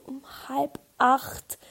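slow, disbelieving whisper; stunned at the early appointment; shocked whisper. Retold or imitated. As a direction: breathe out halb acht in horror. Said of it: "Und ich so".

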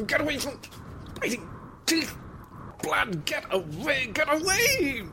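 high-pitched frantic cries, fighting off an attacker; breathless high panic, gasping at the end; panicked screaming. Acted. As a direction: scream in terror as it claws at you. Bat attacks his face and throat.

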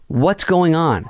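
high, bewildered call; retold alarm. Calling to attacker from behind a car, retold alarm.

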